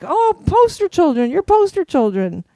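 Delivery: high-pitched, gushing realization; amazed recognition. Retold or imitated. Musician recognizing band members, high-pitched amazement. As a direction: imitate a delighted jump back.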